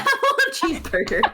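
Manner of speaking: laughing. Real, not acted. Echoing a joke, laughing.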